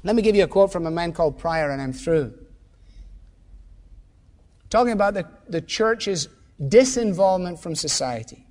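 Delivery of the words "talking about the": ordinary talk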